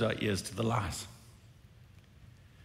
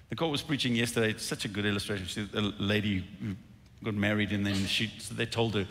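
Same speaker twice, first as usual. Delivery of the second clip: usual speaking voice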